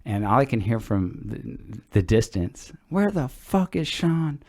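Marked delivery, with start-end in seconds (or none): 2.9-4.4 high, slow mock yell from across the room; retold scream. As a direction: imitate an angry father yelling from afar, pitched up. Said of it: "he's screaming my name".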